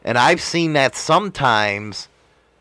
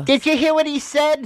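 high-pitched tattletale imitation; mock gossiping commenter; 14 semitones higher than usual. Commenter running to tattle on another show, mock gossip voice. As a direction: mimic an eager tattler, pitched way up.

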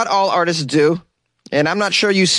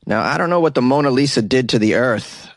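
ordinary talk, nothing marked

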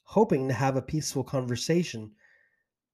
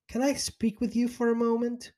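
fake sweet; 9 semitones higher than usual. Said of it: "made sure the fake smile was still in place".

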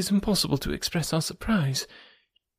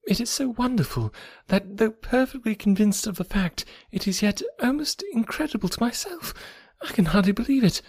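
eager; 9 semitones higher than usual. Acted. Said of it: "talking on with eagerness".

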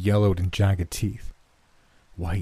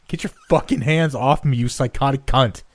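high, strained, choked shout; strained breath; 6 semitones higher, 3 dB louder than usual. A narrator's reading. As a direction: force it out while being choked. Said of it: "squeezed out through a strained breath".

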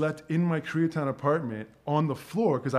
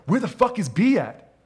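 angry doctor; 7 semitones higher, 7 dB louder than usual. Retold or imitated. Retold doctor yelling in OR.

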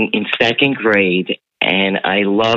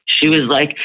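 plain reading voice

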